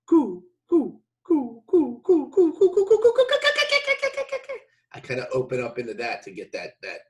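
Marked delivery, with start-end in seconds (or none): 0.1-4.6 high coo building faster and higher; kookaburra call. Shown as a demonstration. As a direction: start slow and speed up, climbing in pitch like a kookaburra. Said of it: "it starts out slow, low".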